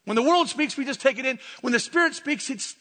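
ordinary talk, nothing marked

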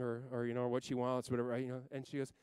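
ordinary talk, nothing marked

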